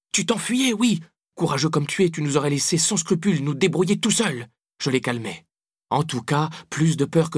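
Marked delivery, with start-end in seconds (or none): 0.1-4.5 put-on high-pitched accusing girl; indignant. A narrator's reading. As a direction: accuse him hotly, pitch raised. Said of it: "Mathilde était indignée".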